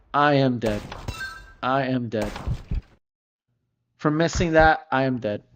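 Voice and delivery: ordinary talk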